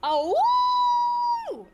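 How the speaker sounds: loud howling wolf cry. Acted. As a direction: throw your head back and howl. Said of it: "like a wolf awoo".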